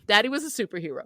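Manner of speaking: usual speaking voice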